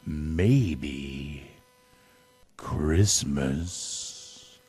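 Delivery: tender dawning wonder, word by word; quiet realization. A narrator's reading. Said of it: "he thought".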